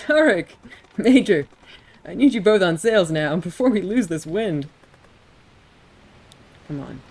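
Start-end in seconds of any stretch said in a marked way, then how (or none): 0.1-0.5 calls out a name loudly across the deck, laughing; a bright laughing call to the crew; laughing shout. Acted. 1.0-4.7 loud commands bellowed over the wind; shouted orders. Acted. Captain orders crew to sails.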